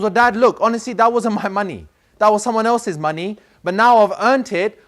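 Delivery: put-on boy explaining to his father it was others' money, high and insistent; insistent pleading. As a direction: plead it out, up and loud.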